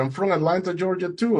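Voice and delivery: high, excited discovery, retold; surprised delight. Boatman finding the swimmer shares his hometown, surprised delight.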